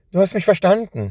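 high, loud happy cry; joyful exclamation. A narrator's reading. Boy overjoyed that his mouse understood, exclaiming. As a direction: burst out in joy. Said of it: "hätte er am liebsten ausgerufen".

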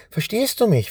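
high, pleading question; desperate question. A narrator's reading. Boy begging his mouse to understand, desperate. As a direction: ask it in despair. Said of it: "fragte Benjamin verzweifelt".

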